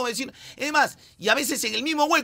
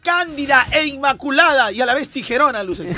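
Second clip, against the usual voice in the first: mock flowery praise; 5 semitones higher, 6 dB louder than usual.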